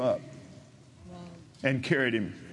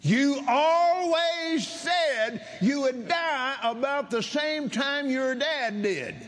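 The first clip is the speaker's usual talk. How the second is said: very high, slow shout; roaring lion of a preacher; 12 semitones higher, slower than usual. Retold or imitated. Preacher repeats the man's own words at him, roaring. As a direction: hammer it out in a long high roar. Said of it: "he was a roaring lion".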